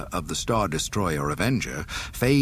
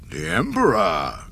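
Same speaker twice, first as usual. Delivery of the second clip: Darth Vader voice; 5 dB louder than usual. Acted. Vader repeats the news.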